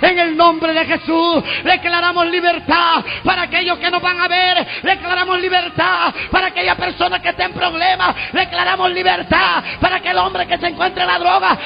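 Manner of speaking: high chanted declarations, building to a drawn-out shout; full-force preaching. Acted. Preacher declaring freedom for prisoners and addicts, high impassioned proclamation.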